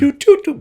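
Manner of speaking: high, loud doot doot beeps; flip phone dialing. Retold or imitated. Mickey dialing his dad, high phone beeps. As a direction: beep out each key like an old phone. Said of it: "like it was a flip phone".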